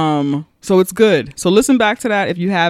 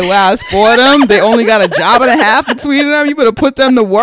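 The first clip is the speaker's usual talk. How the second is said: high, loud mock outrage; mocking exclamation; 5 semitones higher, 8 dB louder than usual. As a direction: play it as a scandalized joke. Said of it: "I like".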